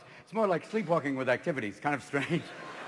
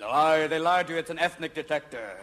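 normal talking voice

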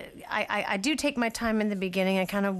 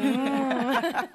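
mock moan; 6 semitones higher, 4 dB louder than usual. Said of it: "moaning away, going".

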